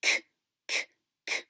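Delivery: K as a voiceless click, repeated; just the K release, no vowel; final sound alone. Shown as a demonstration. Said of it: "What's that final sound?".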